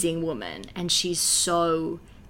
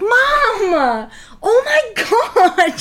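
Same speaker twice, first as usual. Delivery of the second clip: high, loud laughing outburst; exploding teen outburst; 14 semitones higher, 9 dB louder, much slower than usual. Retold or imitated. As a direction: blow up at mum, half laughing. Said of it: "She just explodes".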